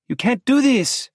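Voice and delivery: high, loud angry protest; angry Lola. A narrator's reading. Lola protests as Santi leaves, high angry cry. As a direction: burst out at him in anger. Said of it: "Lola says angrily".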